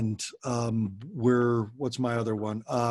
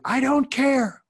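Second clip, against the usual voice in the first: put-on much higher and louder, a sulky kid's yell; sulky child; 13 semitones higher, 6 dB louder, slower than usual. Shown as a demonstration. Daughter refusing her parents, high loud retort. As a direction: fling it back like a stubborn teen. Child snapping back in demo.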